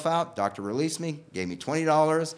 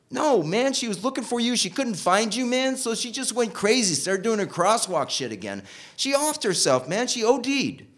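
lightly voiced friend, pitched high; retold friend; 9 semitones higher, 3 dB louder than usual. Squirrely's friend breaking the news, higher voice. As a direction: tell the bad news in a raised, excited friend voice.

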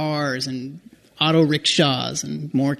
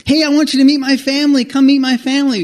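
much higher and louder, quick and eager; eager friend; 10 semitones higher, 6 dB louder than usual. Retold or imitated. The friend inviting the tourist to meet his family, quick and much higher. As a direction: invite him in with rushed enthusiasm.

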